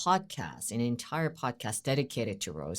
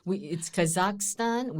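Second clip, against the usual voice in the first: ordinary delivery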